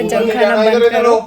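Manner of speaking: loud mock-stern doctor's order; quoting the doctor. Vlogger imitating her doctor's warning, loud mock-stern. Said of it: "उसने बोला".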